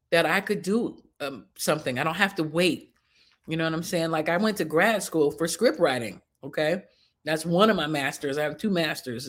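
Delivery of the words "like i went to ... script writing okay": ordinary delivery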